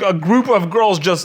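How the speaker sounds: ordinary talk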